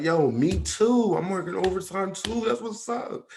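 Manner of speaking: high-pitched, eager agreement; excited friend. Retold or imitated. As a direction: imitate a friend thrilled at the coincidence. Friend eagerly sharing overtime plans, retold.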